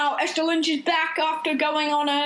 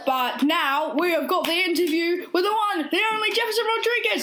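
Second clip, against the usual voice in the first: big hype intro; excited announcer; 7 semitones higher than usual. Acted. Announcing the special guest.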